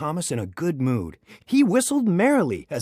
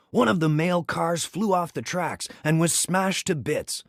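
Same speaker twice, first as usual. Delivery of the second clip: ordinary delivery, nothing marked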